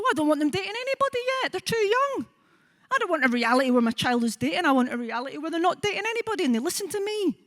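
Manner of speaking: anxious parent. Retold or imitated. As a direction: rush it out like a panicking parent refusing to let go. Worried parent jumping to conclusions.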